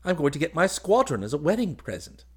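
put-on high, loud, boyish chatter; rattling on happily. A narrator's reading. Groom boasting to his cousin of promotion, excited. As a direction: chatter on, full of your good news. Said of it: "he rattled on".

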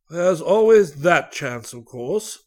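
slow, a touch of regret; regretful. Acted. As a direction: concede it slowly with mild regret. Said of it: "he said regretfully".

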